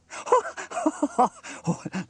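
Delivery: high, loud character laugh; hearty acted laugh. A narrator's reading. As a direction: laugh out loud as the delighted inventor. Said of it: "laughed Sam".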